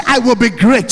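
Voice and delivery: loud chant-like vow for the crowd to repeat; shouted affirmation. Shown as a demonstration. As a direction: lead the hall in a rallying vow.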